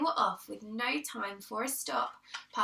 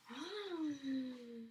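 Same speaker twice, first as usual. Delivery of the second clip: sharp quiet gasp; 10 dB quieter than usual. Acted. Listeners sense the thief nearby, gasping. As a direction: gasp at the discovery. Discovery in the story.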